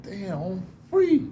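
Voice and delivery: slow, high-pitched wonder; slowly dawning amazement; amazed realisation. Retold or imitated. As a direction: draw it out in disbelief. Acting out the realisation of freedom.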